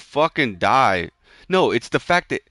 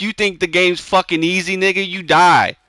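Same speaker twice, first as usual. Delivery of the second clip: higher and louder, fed-up rant; exasperated scolding; 6 semitones higher, 5 dB louder than usual. Real, not acted. Ranting at his brother for dying, heated and louder. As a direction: snap at him for thinking it's easy.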